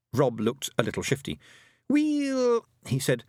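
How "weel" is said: high, cocky Scots brogue; a proud wee Feegle warrior; broad Scots Feegle; Scottish pixie voice. A narrator's reading. Rob Anybody's Feegle voice.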